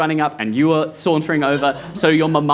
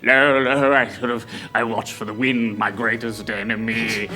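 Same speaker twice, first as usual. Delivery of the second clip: put-on fallen old butler.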